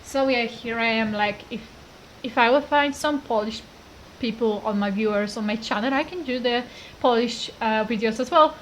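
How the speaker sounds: ordinary talk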